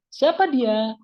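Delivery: "Siapa dia" asked high and loud; questioning intonation. Shown as a demonstration. Teacher translating the question for students, questioning intonation on "Siapa dia". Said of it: "itu intonasi bertanya".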